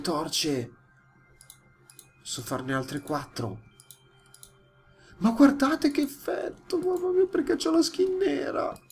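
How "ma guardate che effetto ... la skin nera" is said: startled. Real, not acted. Surprised by the visual effect.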